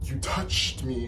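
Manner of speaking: high, slow, stunned statement; retold shock. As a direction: act out disbelief, stretching the words. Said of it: "I'm, like, shocked".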